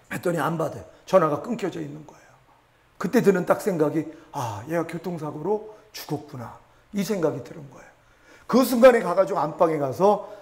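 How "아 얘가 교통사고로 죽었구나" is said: slow hushed dread; softer, slow, stunned realization; dread thought. Retold or imitated. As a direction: breathe out the fear that she is dead. Father's dread thought of daughter's death.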